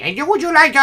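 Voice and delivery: high, lightly voiced caller imitation; hint of a sales caller; mock telemarketer. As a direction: put on the pushy caller voice. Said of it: "like, call you".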